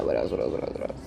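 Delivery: very low, quiet muttering; quick private mumble while searching for the thought; muttering to self. Real, not acted.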